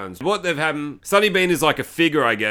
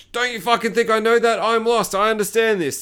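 high-pitched bickering retort; mock irritation; 7 semitones higher than usual. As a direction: imitate a snappy lost driver. Lost family argue.

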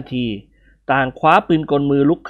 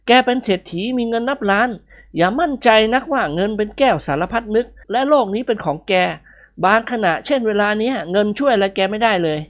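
higher, lecturing friend; character voice; 7 semitones higher than usual. A narrator's reading. Friend lecturing the millionaire, higher character voice.

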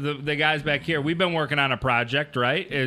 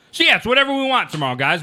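fast hype announcer; 6 semitones higher, 4 dB louder, faster than usual. Acted. Catchphrase pitched to stream viewers.

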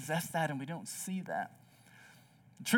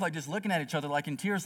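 ordinary talk

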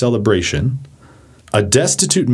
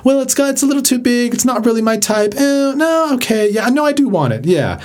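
high-pitched dithering fusspot; persnickety person; 11 semitones higher than usual. Acted. Fussy shopper who can never decide, high persnickety voice. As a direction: waver fussily, voice pitched way up. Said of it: "That's the persnickety person".

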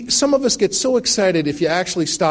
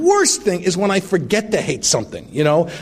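ordinary talk, nothing marked

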